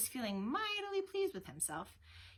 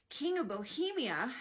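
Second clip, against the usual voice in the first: slow, raised cry of surprise; higher, louder, drawn-out astonishment; astonished exclamation; 5 semitones higher, 5 dB louder than usual. A narrator's reading. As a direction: exclaim it in amazement.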